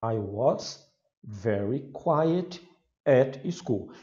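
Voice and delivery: slow, careful English model sentence; teacher modelling English slowly; English pronunciation demo. Shown as a demonstration. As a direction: spell out each word slowly for students. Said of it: "Então, was".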